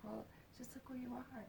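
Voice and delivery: hushed, gentle queen voice; soft queen. Retold or imitated. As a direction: speak softly like a calm queen. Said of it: "And she's like".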